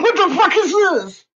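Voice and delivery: high, loud, aghast; high-pitched retold disbelief; mock shock. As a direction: blurt it out, stunned. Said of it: "I'm like".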